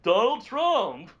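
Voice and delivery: loud, slow Seinfeld-style observational question; Jerry Seinfeld impression. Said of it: "supposed to be Jerry Seinfeld".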